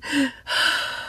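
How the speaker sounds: heavy, breathy sigh; dramatic mock-tearful exhale; acted distressed sigh. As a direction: let out a big upset breath as the friend. Acting out the bawling friend.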